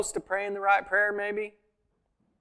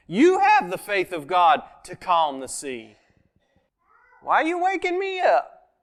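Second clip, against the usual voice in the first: Jesus, amused rebuke; 3 semitones higher, 4 dB louder than usual. Retold or imitated. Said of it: "to me, he's implying".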